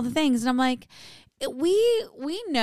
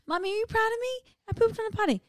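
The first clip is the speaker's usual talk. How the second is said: put-on high little kid voice; child voice; 11 semitones higher, faster than usual. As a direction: pitch way up like a toddler. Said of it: "I'm like".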